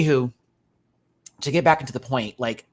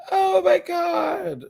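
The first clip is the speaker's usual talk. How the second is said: slow, high, louder laughing "oh my God"; laughing cry; 6 dB louder, much slower than usual. Real, not acted. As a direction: wail it through laughter. Reacting in disbelief, laughing.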